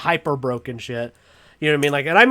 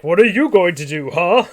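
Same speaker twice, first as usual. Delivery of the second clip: taunting villain challenge, slightly loud; mock villain taunt; Cell; 3 semitones higher, 6 dB louder than usual. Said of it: "Cell's like".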